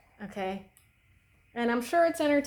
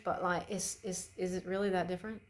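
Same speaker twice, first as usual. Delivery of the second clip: usual speaking voice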